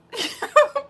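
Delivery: short high laugh; high giggle. Real, not acted. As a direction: giggle at the chat. Laughing at chat.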